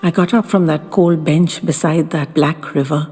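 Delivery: usual speaking voice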